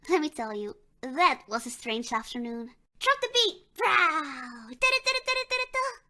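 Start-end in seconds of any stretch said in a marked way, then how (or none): 3.0-3.6 high, excited call to the DJ; hyped call. Pretend. Starting a dance beat. 3.8-6.0 high mouth-made techno beat; beatboxing. Pretend.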